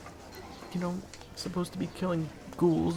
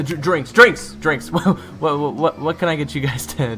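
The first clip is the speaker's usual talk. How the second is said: loud, tripping over words, giggling; flustered stammering laughter; 10 dB louder than usual. Acted. Waiter Zach fumbling the drink order, laughing. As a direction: stumble and laugh through the question.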